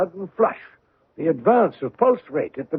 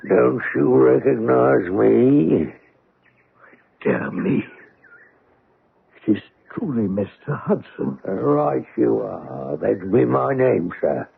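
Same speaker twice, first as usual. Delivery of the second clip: put-on cringing old sailor; 8 semitones lower, slower than usual. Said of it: "a cringing manner".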